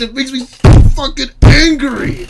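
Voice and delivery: mock angry shout while laughing; mock desk-pounding rage. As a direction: act out the raging guy pounding his desk. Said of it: "pounding on his fucking desk".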